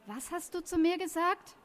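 acted outrage in a story reading; indignant challenge. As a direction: demand an explanation for the insult. Acted quarrel, confronting an insult.

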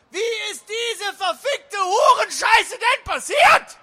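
high, drawn-out furious shriek; shouted foul insult. Acted. The obscene insult calls out at the meeting, shrieking. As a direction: scream it out in exaggerated outrage. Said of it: "rief die unflätige Beleidigung".

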